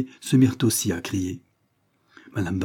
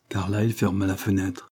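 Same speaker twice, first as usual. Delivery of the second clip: ordinary delivery, nothing marked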